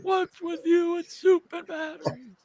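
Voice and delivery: put-on high, slow old man voice; the older brother visiting Fred, puzzled and slow. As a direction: drag it out like a creaky old man.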